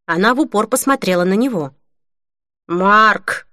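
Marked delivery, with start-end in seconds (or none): 2.7-3.5 a short, restrained call. A narrator's reading. Cashier summons Mark from the back room, calling out. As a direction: call a coworker over, half-raised voice. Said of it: "крикнул кассир".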